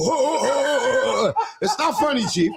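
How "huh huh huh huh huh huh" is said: high huh grunts with a laugh; mock panting. Teller imitating Greg bending over, laughing huhs. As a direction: puff out huhs, half laughing. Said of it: "he used to bend over and go".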